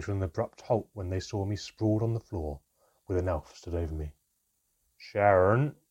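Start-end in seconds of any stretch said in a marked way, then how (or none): 5.0-5.8 loud call across the clearing; shouting back to Sharon. A narrator's reading. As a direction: call the name out over your shoulder. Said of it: "Handown called over his shoulder".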